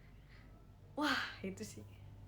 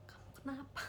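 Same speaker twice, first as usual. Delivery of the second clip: soft, higher-pitched cooing worry; mock concern; 5 semitones higher, 8 dB quieter than usual. Fan asking the second lead what is wrong, softly concerned. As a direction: gently fuss over them, pretending.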